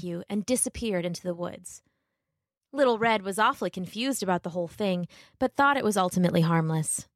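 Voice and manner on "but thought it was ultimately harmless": ordinary delivery, nothing marked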